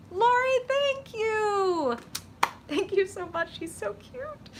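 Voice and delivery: high, squealy thank you to a viewer; gushing thanks. Real, not acted. Streamer gushing thanks to viewer Lori, high and squealy. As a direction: gush over the sweet comment.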